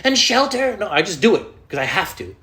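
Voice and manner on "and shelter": mock motivational speaker. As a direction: ham up a pep-talk guru. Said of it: "I don't need to be motivated every day to be like".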